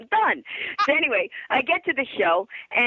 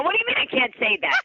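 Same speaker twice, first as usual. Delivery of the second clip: high-pitched retold protest; indignant; 10 semitones higher than usual. Guest arguing with the show's crew, indignant retold protest. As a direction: push back in mock indignation. Retold argument with show staff.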